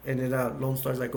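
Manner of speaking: usual speaking voice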